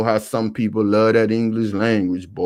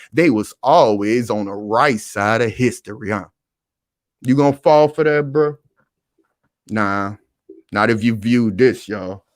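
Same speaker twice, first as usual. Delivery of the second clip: usual speaking voice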